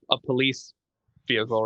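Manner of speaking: usual speaking voice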